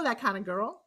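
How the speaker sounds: ordinary delivery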